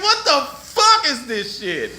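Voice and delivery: high, slow incredulous cry; hammed-up baffled outrage; retold disbelief. Retold outraged disbelief, then laughs.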